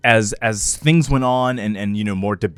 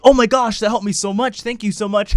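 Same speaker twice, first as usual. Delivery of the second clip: high-pitched gushing thanks; imitated grateful fan; 9 semitones higher than usual. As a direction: gush like a thankful viewer. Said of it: "I would still get messages years later from that saying".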